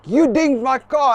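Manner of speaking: imitating an angry car owner; aggro accusation. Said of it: "this guy is just super aggro".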